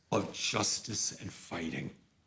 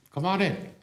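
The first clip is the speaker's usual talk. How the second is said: eager welcome; 9 semitones higher, 5 dB louder than usual. Retold or imitated. Rabbi welcomes them warmly.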